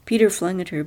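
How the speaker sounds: ordinary delivery, nothing marked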